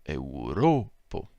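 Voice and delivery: Europo drawn out very slowly, stress on ro; patient retake of Europo, very slow. Shown as a demonstration. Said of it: "faut que je recommence".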